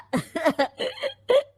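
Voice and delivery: a quick amused laugh after the joke; short laugh. Real, not acted. Friend laughing at his own dig about sons as investment. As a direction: laugh it off lightly.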